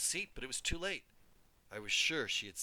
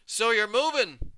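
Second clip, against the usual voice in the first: big, high, slow booming hello; cheerful shout; 10 semitones higher, 9 dB louder than usual. A narrator's reading. Mover John greeting the girl with a cheerful shout. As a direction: boom it out cheerfully. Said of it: "John shouted cheerfully at me".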